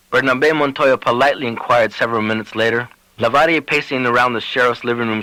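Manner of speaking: ordinary delivery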